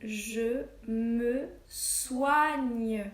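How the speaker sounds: je me soigne stretched out extremely slowly; very slow dictation. Shown as a demonstration. Teacher dictating the last phrase slowly to pupils. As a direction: draw out every word for the class. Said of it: "et le dernier".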